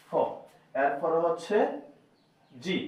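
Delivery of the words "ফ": ফ as the sound of English F; letter sound demo. Shown as a demonstration. Teacher showing learners the sound of F, ফ sounded alone. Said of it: "ফয়ের মতো হবে".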